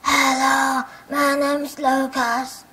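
slow, strained duck-like voice. Shown as a demonstration. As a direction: let the quacky duck voice out. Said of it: "This is the sound when my dog comes out".

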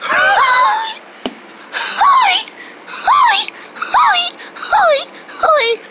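repeated high hoarse "hi" squeaks; strange squeaky noise. Acted. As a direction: test a broken voice in short squeals. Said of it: "don't ever make that noise again".

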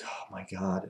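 soft, breath-only groan of dismay; retold whispered grumble; whispered exasperation. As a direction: whisper it wearily to yourself. Woken up, dismayed by night visitor.

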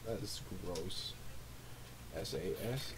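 usual speaking voice

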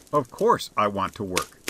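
ordinary delivery

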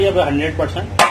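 ordinary talk

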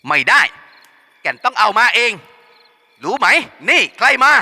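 bullying thug. Acted. Henchman bossing Kaen about, loud and swaggering. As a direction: push her around, full of himself.

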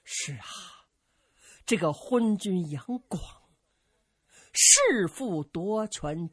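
slow, heavy listing of crimes; grave indignant elder. Acted. Zhang Delu denounces the emperor, slow grim voice. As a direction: spell out each crime with grim weight. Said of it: "紧皱双眉呀".

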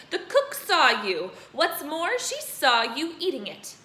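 loud, slow accusation; furious headmistress. Acted. Headmistress loudly accusing Bruce. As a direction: hammer the point home. Trunchbull continues.

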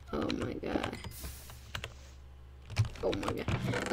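whispered disbelief; whispering. Real, not acted. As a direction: whisper it in shock.

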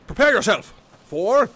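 loud, high theatrical incantation; wizard casting spells. Acted. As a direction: announce your attack like a grand sorcerer.

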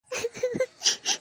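a high, soft, crying little laugh; weepy laugh, choked up; tearful sob-laugh. Acted. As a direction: let the joy spill into tears. Overwhelmed at the birthday surprise.

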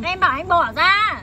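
loud, drawn-out order to let go; exasperated, slow and forceful; firm angry demand. Acted. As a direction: raise your voice, stretch each word in warning. Woman orders man to let go.

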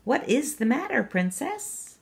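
lightly voiced frog, gently asking; frog asking again. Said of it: "The frog asked again".

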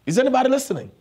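high-pitched challenge to the congregation; calling out. Real, not acted. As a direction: jump up high to demand their attention. Preacher checks on congregation.

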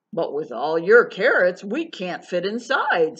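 mildly baffled beaver; a bit bewildered. A narrator's reading. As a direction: protest in mild bewilderment. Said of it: "a bit bewildered".

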